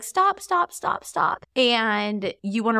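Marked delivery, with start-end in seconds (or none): 0.0-1.5 high, pleading stop from the brother; annoyed sibling. Retold or imitated. Brother to singing sibling, high fed-up pleading. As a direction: mimic a fed-up kid. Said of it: "the other one's like".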